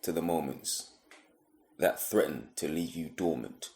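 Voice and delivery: spoken-word poem, measured phrases with long pauses; deliberate spoken-word delivery; poetry recitation. Acted. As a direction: recite it line by line, letting each phrase fall.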